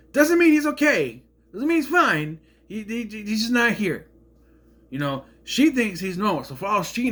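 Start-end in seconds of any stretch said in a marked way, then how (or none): 0.1-4.1 high, loud exasperation; indignant. Real, not acted. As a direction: protest it, much louder and higher. Indignant rant at the show.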